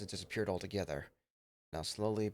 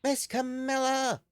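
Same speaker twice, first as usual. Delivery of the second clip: much higher, eager yappy puppy voice; excited little dog; 13 semitones higher, 7 dB louder than usual. Acted. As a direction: squeal it out like an eager pup.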